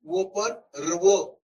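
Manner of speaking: normal talking voice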